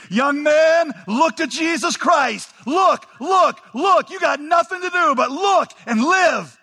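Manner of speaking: high, loud revivalist holler; preacher's shout. Acted. Preacher urges miserable young visitor to look, high fervent shout. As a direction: shout it full force like a Primitive Methodist. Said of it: "he shouted, as only a primitive Methodist could".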